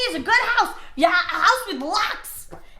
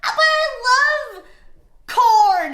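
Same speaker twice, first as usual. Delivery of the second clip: dreamy corn lover; 5 semitones higher, 3 dB louder, much slower than usual. Retold or imitated. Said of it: "they'll be like".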